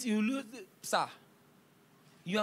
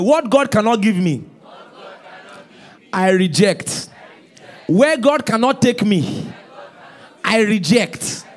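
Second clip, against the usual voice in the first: loud slow declaration for congregation to repeat; call and response; 10 dB louder, slower than usual. Shown as a demonstration. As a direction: lead them in shouting it back. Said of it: "Everybody say after me".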